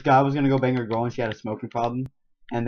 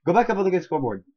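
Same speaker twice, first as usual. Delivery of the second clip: ordinary talk, nothing marked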